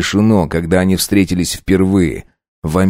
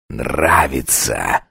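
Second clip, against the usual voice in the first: low slow vampire hiss; 7 semitones lower, 4 dB quieter, much slower than usual. Acted. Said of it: "прошипел вампир".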